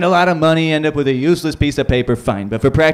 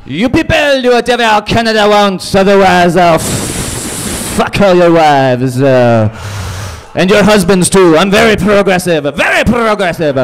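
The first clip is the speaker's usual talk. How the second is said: loud, higher, hammed-up swaggering demands; hammed-up Trudeau; 7 semitones higher, 5 dB louder than usual. Trudeau barging into the UN making demands, loud hammed-up swagger.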